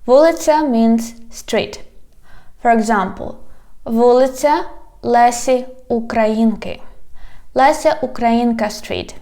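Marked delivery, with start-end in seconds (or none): none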